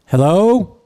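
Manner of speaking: imitating an old man greeting the room, loud drawl; slow-talking old Florida man. Old man opening his talk to the room, imitated drawl. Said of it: "he gets up there and he's like".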